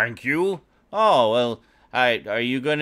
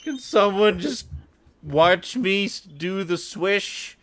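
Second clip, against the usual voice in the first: higher, slowing pleading whine; whiny pleading voice; 7 semitones higher, slower than usual. Pretend. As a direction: beg pathetically, dragging the end. Voicing sad carnival player.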